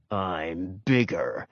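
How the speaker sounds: low, slow, soft growl. A narrator's reading. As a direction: growl it low, like a big threatening dog. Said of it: "growled Ranger".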